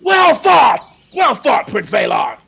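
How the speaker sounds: high, loud repeated cheering shout. Acted. Lords in the viewing stand hailing Valar, shouting. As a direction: bellow the praise from the stands.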